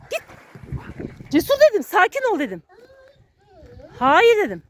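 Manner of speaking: loud high yells, near screaming; frantic shouting. Real, not acted. Owner screaming at her dog Cesur during a dog fight, frantic shouting. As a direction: holler commands at a dog out of control.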